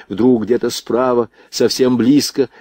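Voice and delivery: ordinary delivery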